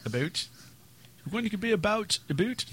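put-on Canadian accent. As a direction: play up a Canadian "about". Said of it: "slight Canadian accent".

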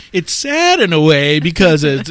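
normal talking voice

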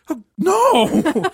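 loud, high, drawn-out mock horror; horrified denial. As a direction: cry out in laughing disbelief. Friend's shocked denial, retold laughing.

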